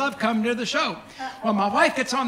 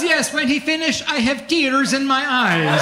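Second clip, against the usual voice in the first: put-on Eastern European accent; 3 semitones higher, 3 dB louder than usual. Romanian manager telling the wife of her tears, Eastern European accent. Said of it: "beautiful Eastern European accent sounded like this".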